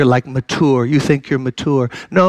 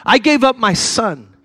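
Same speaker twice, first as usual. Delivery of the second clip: higher, pleading preacher's voice; impassioned preaching; 7 semitones higher than usual. Acted. Preacher urging listeners to give their lives to Christ, impassioned. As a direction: plead with them to turn.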